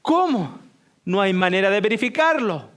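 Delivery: high, loud scoff; incredulous teacher. Acted. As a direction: cut the boy down in disbelief. (profesor incrédulo y fuerte)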